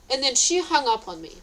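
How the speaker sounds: usual speaking voice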